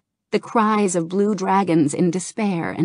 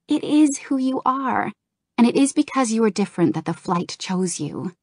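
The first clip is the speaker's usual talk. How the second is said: higher, sincere female role voice; reassuring Kirigosa; 5 semitones higher than usual. Acted. Kirigosa comforting Kalik about the Flight, sincere. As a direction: reassure him he was chosen.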